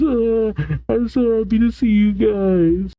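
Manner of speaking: high, small voice of relief; tearfully relieved Chopper. A narrator's reading. Chopper greets his rescuers, high relieved little voice.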